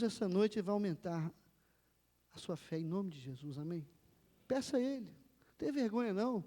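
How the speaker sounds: usual speaking voice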